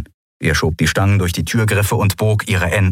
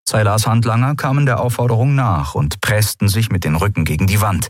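usual speaking voice

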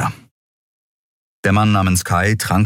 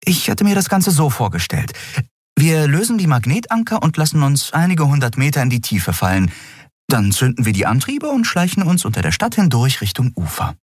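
higher, animated planning voice; eager, slightly put-on character voice; scheming Endriel; 10 semitones higher than usual. A narrator's reading. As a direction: lay out the plan in a raised pitch.

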